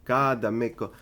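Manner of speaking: put-on laughing Italian accent, pizza-pie style. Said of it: "Are you Luigi?".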